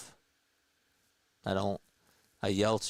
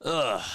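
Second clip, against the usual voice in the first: frustrated groan; 5 semitones higher, 5 dB louder than usual. Real, not acted.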